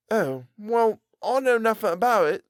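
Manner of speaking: weary, sighing reply; a tired, dejected shrug in the voice. Acted. Tobias sighing to the tailor, weary. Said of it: "said Tobias with a sigh".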